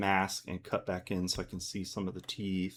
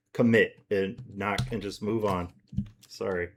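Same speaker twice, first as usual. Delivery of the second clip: normal talking voice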